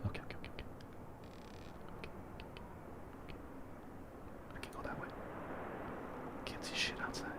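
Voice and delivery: hushed whisper while sneaking; sneaky whispered self-talk. Real, not acted. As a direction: whisper it so no one hears. Sneaking through the dark.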